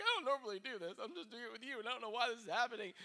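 mock crying. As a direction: pretend to blubber through an embarrassed confession. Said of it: "they're, like, crying".

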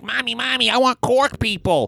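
put-on high-pitched pleading kid voice; whiny child. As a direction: beg your mother like an awful little child. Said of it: "you sound like an awful child".

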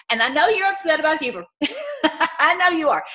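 laughing teasingly; giggling, playful jab. Real, not acted. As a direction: tease them through a laugh. Teasing viewer about being upset.

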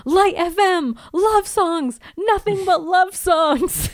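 high, exasperated outburst, slowing at the end; hammed-up crazed complaint; mock hysterical ranting. Buffy ranting about her roommate's music, mock hysterical.

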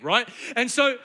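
ordinary delivery

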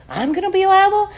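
high, indignant mock question; incredulous echo. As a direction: throw it back in high-pitched disbelief.

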